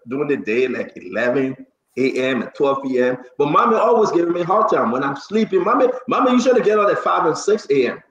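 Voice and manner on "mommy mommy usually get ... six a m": normal talking voice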